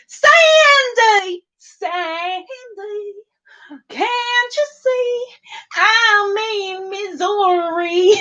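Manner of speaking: high, drawn-out mock American drawl; over-the-top high American twang, long vowels; badly faked American accent. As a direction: wail it slowly in a hammed-up American accent. I can't do your accent.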